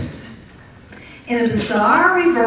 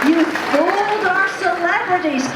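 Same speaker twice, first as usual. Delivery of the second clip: prize announcer; 5 semitones higher than usual. Acted. Host announces prize win.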